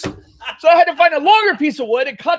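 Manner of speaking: high, loud laughing complaint; laughing exasperation. Real, not acted. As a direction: laugh through the frustration. Laughing at his misfortune.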